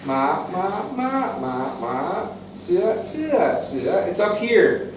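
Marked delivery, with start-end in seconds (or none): 0.0-2.3 "Ma, ma, ma, ma, ma." in Thai tones, each pitch different; "ma" said in five Thai tones. Shown as a demonstration. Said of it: "Thai language is up here". 2.5-4.0 "Sua, sua, sua." in changing Thai tones; "sua" demonstrated in Thai tones. Shown as a demonstration. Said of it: "Thai language is up here".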